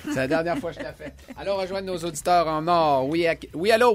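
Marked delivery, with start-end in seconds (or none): 0.0-1.1 laughing through the words. Real, not acted. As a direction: say it while laughing. Laughing repeat.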